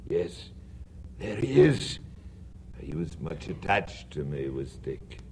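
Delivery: soft, tender remembering; quietly touched memories; fond, hushed. Acted. Old Scrooge fond, hushed.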